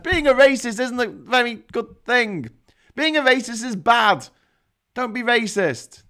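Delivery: loud, high mock scolding; emphatic admonishing. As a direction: spell it out forcefully, like a conscience telling someone off. Voicing conscience scolding against racism.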